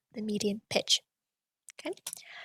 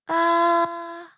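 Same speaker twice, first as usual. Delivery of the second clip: high, louder sustained ah; high pitch ah; 6 semitones higher, 4 dB louder than usual. Shown as a demonstration. Teacher showing the high pitch once more, a high ah. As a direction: hold ah on a high level note. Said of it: "locate the high pitch".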